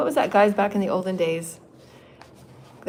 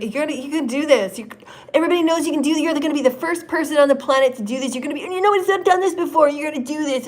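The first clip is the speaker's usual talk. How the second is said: high, loud, eager cheering; rally her like a proud team; excited encouragement; 8 semitones higher, 5 dB louder than usual. Retold or imitated. Her spirit team cheers her on, high and excited.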